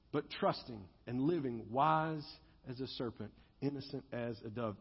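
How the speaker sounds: hushed solemn quoting; reverent hush. Acted. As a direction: let the scripture settle gently. Scripture refrain softly.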